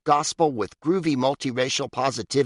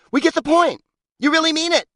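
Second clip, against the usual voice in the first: mock exasperation; 4 dB louder than usual. Mocking overused exclamation points.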